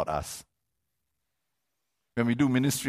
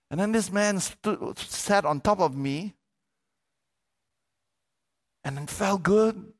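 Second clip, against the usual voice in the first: higher, proud, excited donkey voice; happy donkey; 5 semitones higher than usual. Retold or imitated. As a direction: gush like a flattered donkey. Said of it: "Donkey goes".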